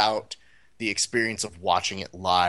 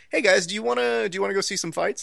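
raised, upbeat pitch to the group; acted-out hopeful invite, higher in pitch; eager invitation; 8 semitones higher, faster than usual. Friend inviting pals to see some fights, eager.

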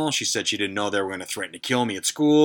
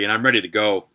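usual speaking voice

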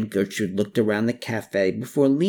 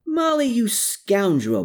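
high, playful mock bellow; 11 semitones higher than usual. A narrator's reading. Man greeting his scoundrel friend, bellowing mildly. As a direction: hail an old friend with a mild bellow. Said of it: "bellowed a male voice".

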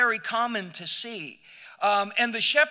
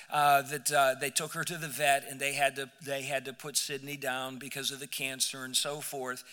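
quiet grief; 6 semitones lower, 5 dB quieter than usual. Real, not acted. Grandpa telling the church his dog Sydney was put down, quietly grieving. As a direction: lower and softer, sadness pulling the voice down.

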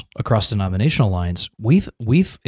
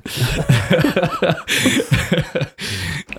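hearty laugh; 6 semitones higher than usual. Real, not acted. Joke about miles, big laugh.

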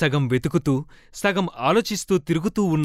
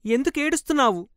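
higher, quicker little voice of Meera; child's worried question; 9 semitones higher, faster than usual. Acted. Meera finds her crying by the river and asks quickly, high voice. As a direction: ask it fast and high like a small boy. Meera's voice asking why she cries.

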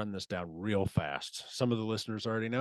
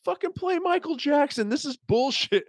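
very high, loud mock outrage; imitated angry demand; 6 dB louder than usual. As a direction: yell it like the fed-up best man, pitch way up. Best man's angry demand retold.

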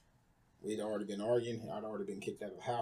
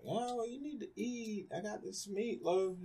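sweetly talking her son into eating; coaxing mom; 11 semitones higher than usual. Retold or imitated. Mom urging her son to eat the meatloaf, high and coaxing. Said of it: "she was just like".

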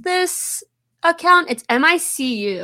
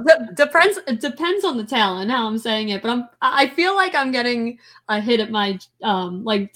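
usual speaking voice

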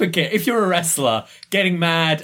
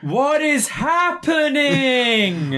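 high, drawn-out mock wail; Roddy; 12 semitones higher, much slower than usual. Co-host imitating a confused Roddy, overblown high wail. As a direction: stretch it into a hammed-up cry of bewilderment. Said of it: "says Roddy".

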